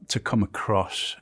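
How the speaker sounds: ordinary talk, nothing marked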